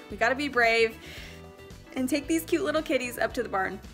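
ordinary talk

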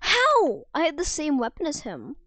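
loud, high-pitched disbelief; indignant outcry. Real, not acted. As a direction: burst out in loud protest. Protests at losing a fight.

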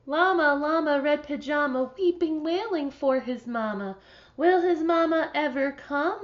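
storytime read-aloud voice; reading to children. Acted. Teacher reads a picture book to her class, storybook voice. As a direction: read it gently to a class of kids.

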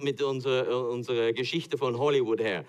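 usual speaking voice

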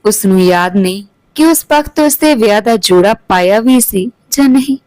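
usual speaking voice

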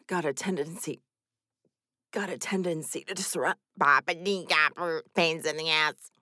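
low robot voice. Shown as a demonstration. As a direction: drone it like a robot. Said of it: "what I sound like as a robot".

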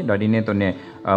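ordinary talk, nothing marked

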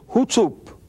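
a lightly voiced Yorkshire "Hootsup", high and clipped short; Yorkshire dialect. Preacher imitating Wigglesworth's Yorkshire greeting, light Yorkshire accent. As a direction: say "Hootsup" as an old Yorkshireman would. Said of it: "in his part of England".

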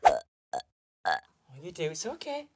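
ordinary talk

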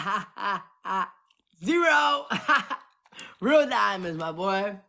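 ordinary talk, nothing marked

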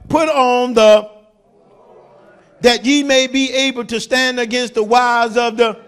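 a preacher shouting verse eleven to the church; loud scripture reading. Real, not acted. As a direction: proclaim the verse to the congregation. Reading scripture at full force.

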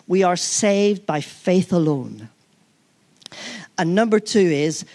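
ordinary talk, nothing marked

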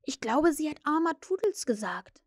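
ordinary delivery

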